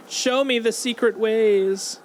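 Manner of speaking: mock grand plea. Player dramatically begs the game for secret paths. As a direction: ask the game like a quest hero.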